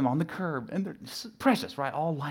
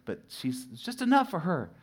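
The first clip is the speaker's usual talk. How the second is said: normal talking voice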